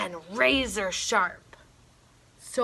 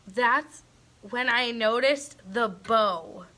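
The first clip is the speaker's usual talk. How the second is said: ordinary delivery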